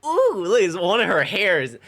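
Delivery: high, loud, mocking imitation of the weird kid; squealing mock excitement over a hair; mocking Daryl. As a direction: ham up the creepy kid's delight. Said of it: "and he said".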